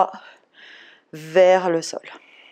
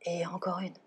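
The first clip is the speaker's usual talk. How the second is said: whispering; 13 dB quieter than usual. Real, not acted. Whispered count during held pose.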